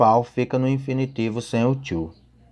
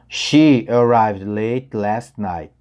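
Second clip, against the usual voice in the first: slow example sentence; 3 dB louder, much slower than usual. Shown as a demonstration. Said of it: "aqui nós temos dois exemplos".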